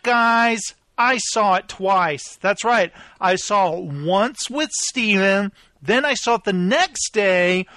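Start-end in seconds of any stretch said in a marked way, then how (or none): none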